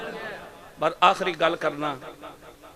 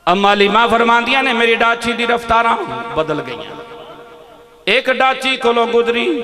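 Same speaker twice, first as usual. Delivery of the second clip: loud, high preacher's proclamation; full-force preaching; 5 semitones higher, 8 dB louder than usual. Acted. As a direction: declaim the story to the hall. Preaching rises again.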